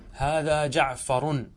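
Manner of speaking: slow "جعفر" said jaʿfarun, ending in -un; voweled final ra. Shown as a demonstration. Teacher shows the moving ra, "جعفر" said jaʿfarun. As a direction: sound out "جعفر" slowly with final -un. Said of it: "ضمة الراء في جعفر".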